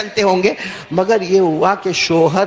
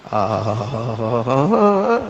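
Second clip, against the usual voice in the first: long sigh; 8 semitones lower, much slower than usual. Real, not acted. Preacher sighs over rare wife.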